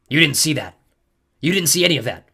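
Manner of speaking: forceful, defensive denial; stern insistence. A narrator's reading. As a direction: swear a witness to silence. Embarrassed Clarice warns hero.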